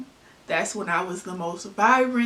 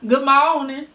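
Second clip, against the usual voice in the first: louder Southern greeting, moderately high; imitated country accent; 6 semitones higher, 8 dB louder than usual. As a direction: belt out a country-accent hello. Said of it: "when you down there and they be like".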